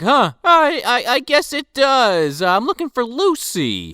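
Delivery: high, dazed Santa voice; confused old Santa. Retold or imitated. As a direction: play him lost and befuddled. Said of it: "he talks crazy immediately".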